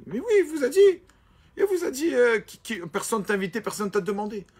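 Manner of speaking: high, loud, outraged protest; indignant outburst. Retold or imitated. Student protesting to his rabbi about the insult, indignant and high. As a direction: insist hotly that he heard it.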